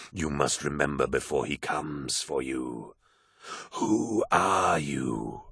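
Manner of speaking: low, very slow, otherworldly wolf; a ghostly wolf questioning the lost hero; eerie wolf voice. Acted. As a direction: draw each word out like a spectral beast. Wolf voice continues.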